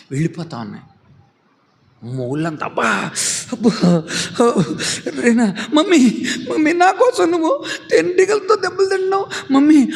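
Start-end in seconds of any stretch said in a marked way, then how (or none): 2.7-9.9 crying out. Acted. Child crying out as mummy is stung for her. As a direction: wail for mummy taking the stings. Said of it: "అని అరుస్తూ".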